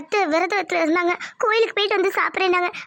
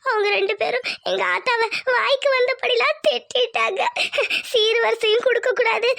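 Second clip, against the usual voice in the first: sobbing between phrases; tearful reporting. Acted. Wife reporting insults to her mother, tearful. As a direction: push the account out through tears.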